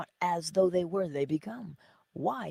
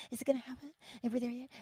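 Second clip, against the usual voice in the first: high, soft, mock-anxious fretting; anxious impatience; 6 semitones higher, 9 dB quieter, much faster than usual. Teacher mimicking a student waiting for results, mock anxious. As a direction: fret like an impatient child, hushed and high. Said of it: "you don't do that".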